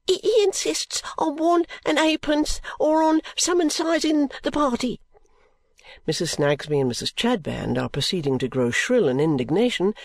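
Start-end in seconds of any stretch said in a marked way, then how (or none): 0.0-5.0 put-on high, rushed servant-girl voice; high, breathless maid voice. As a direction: blurt it out short of breath. Guster's report.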